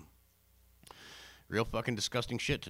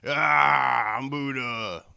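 mock yell; 3 semitones higher, 10 dB louder, much slower than usual. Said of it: "he's like".

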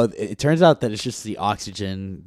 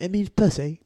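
mildly mimicked British accent, higher pitched; 7 semitones higher than usual. Telling friends what a British training partner said, mock British accent. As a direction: imitate a Brit, voice lifted. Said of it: "he was British".

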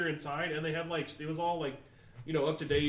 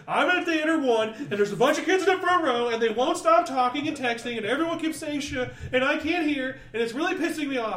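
higher, louder rushed complaint; heated rant; 7 semitones higher, 7 dB louder than usual. Retold or imitated. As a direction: rant at the manager, worked up. Retold heated complaint call.

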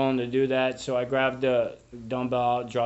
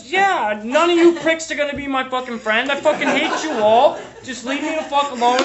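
much higher and louder, half laughing; mock furious shout; 11 semitones higher, 8 dB louder than usual. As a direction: yell it at the whole room, hammed-up. Retold outburst at group members.

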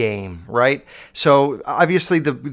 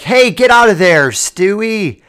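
loud, higher call at the dog over the camera speaker; shouting at the dog; 7 semitones higher, 7 dB louder than usual. Pretend. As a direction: yell through the speaker to scare the dog off the socks. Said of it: "I could be like".

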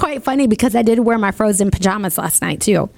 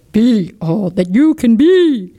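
slow, louder recruiting slogan; manly military voice; 4 dB louder, slower than usual. Shown as a demonstration. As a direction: play it like an army ad. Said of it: "I can use my hair".

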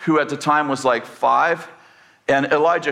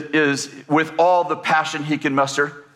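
usual speaking voice

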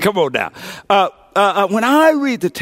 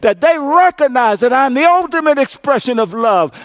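preaching Jesus' words; 4 semitones higher than usual. Acted. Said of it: "he said to them".

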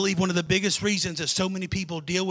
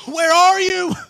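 where are you cried out higher and louder; anguished cry out; 9 semitones higher, 8 dB louder, slower than usual. Acted. Jesus crying out to God in agony, loud and pleading. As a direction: cry it up to heaven, louder than the first ask. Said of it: "he was in such agony".

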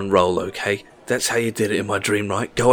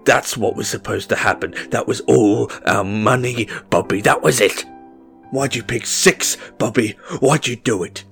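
furious rant; 3 semitones higher, 9 dB louder than usual. A narrator's reading. Red raging at boy.

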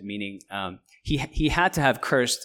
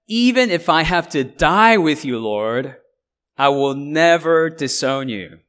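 louder, slow and heartfelt, pitch raised; earnest vow; 4 semitones higher, 6 dB louder, slower than usual. Retold or imitated. Peter vowing loyalty to his Lord, louder, slow and earnest. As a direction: swear it with full conviction, slowly.

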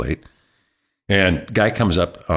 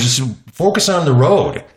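slow, firm, raised instruction; scolding wife; 7 semitones higher, 4 dB louder than usual. Retold or imitated. Wife telling her husband to mind the road, slow and firm. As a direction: spell it out to him slowly, voice raised. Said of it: "she actually scolded me".

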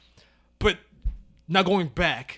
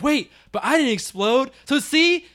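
pleading husband; 8 semitones higher than usual. Retold or imitated. Imitating the husband begging his wife, higher mock plea. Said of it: "And he's like".

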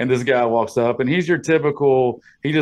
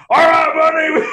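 loud, high yelling imitation; over-the-top shouting boss; 6 dB louder than usual. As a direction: bark it out like an aggressive new boss. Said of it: "He just starts yelling".

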